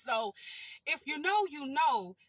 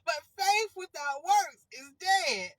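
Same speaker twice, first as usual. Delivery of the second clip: louder and slow, hammering the punchline; emphatic declaration; 5 semitones higher, 8 dB louder, slower than usual. Real, not acted. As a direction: proclaim it like the moral.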